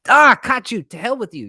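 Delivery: mocking high gloating shout; mean God. A mean God catching a cheater, mock gloating shout. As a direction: ham up a petty, punishing God who catches you out. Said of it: "God's character isn't like this mean, ... miserly God".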